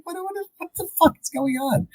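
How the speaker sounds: very high, stammering disbelief; exaggerated high-pitched confusion; mock incredulous. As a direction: squeal it in comic bafflement. Mocks the absurd chase scene.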